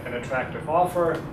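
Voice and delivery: ordinary talk, nothing marked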